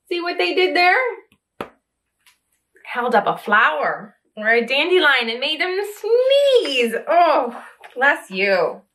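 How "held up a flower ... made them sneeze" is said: plain reading voice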